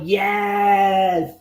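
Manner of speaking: long, drawn-out retold cheer; big yes. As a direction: stretch the word out in triumph. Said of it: "a big ol'".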